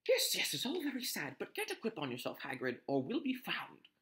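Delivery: usual speaking voice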